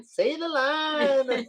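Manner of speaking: high, slow, mock-dramatic plea; quoted catchphrase. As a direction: stretch it out like a running joke. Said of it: "We think it's so funny".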